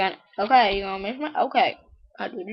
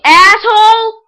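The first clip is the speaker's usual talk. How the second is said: high, very loud shout; driver's angry yell; 12 semitones higher, 18 dB louder, slower than usual. Acted. Driver's swearing voiced in joke.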